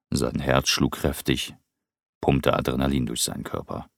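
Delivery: plain reading voice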